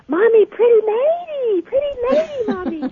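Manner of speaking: put-on high, delighted little boy calling to his mom; high and excited child's voice; toddler voice. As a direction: play the thrilled toddler pointing at the mannequin.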